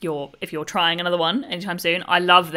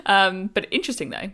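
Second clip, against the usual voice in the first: normal talking voice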